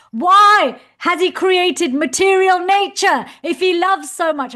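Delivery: loud, slow, insistent question; emphatic demand. Retold or imitated. Young devotee pressing his teacher, loud and slow. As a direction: hammer every word so he cannot miss it. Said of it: "really clear and emphatic".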